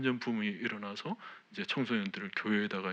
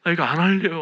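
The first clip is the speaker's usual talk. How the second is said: much louder, higher refusal; loud mock tantrum of quitting; retold defiant outburst; 8 semitones higher, 14 dB louder than usual. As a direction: throw it back at God like a sulking rebel. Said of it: "하면서 막".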